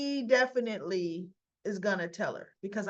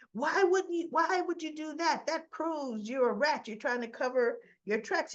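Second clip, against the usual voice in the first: angry accusation; 7 semitones higher than usual. Retold or imitated. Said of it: "Sonny accusing Dex".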